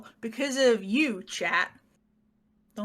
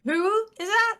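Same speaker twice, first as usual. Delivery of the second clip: coy tease; 11 semitones higher, 4 dB louder than usual. Pretend. Teasing fake ignorance.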